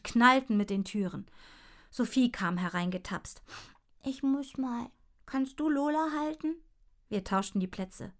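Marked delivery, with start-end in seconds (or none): 4.0-6.6 put-on little girl. A narrator's reading. Little sister voiced.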